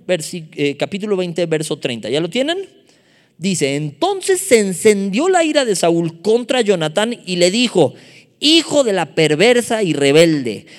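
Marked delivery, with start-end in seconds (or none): 3.8-10.7 loud, raised scripture reading in Saúl's anger; indignant, high-flown rebuke. Acted. Preacher reads Saúl's rage at his son Jonatán, loud indignant declaiming. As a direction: declaim it loudly like an enraged father. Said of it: "Estaba bien altisonante".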